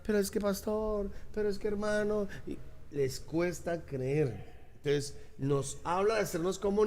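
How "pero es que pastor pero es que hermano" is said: higher, complaining excuse voice, mocking the doubters; playing the doubting believer, higher; whiny doubter. Pastor mimics a doubting churchgoer, higher whining excuse. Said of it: "le dice a uno".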